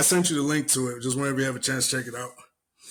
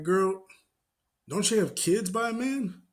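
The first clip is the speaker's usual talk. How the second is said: higher sassy rebuke; sassy callout; 6 semitones higher than usual. Retold or imitated. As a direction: call her out with sass. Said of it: "I'm like".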